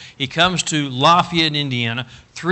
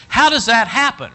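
rhetorical preaching; 9 semitones higher, 5 dB louder than usual. Real, not acted.

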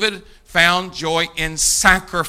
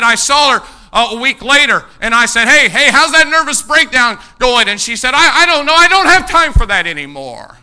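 loud sermon storytelling; preaching at full force; 7 semitones higher, 9 dB louder than usual. Acted. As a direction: tell it loud from the pulpit. Preaching retold story loudly.

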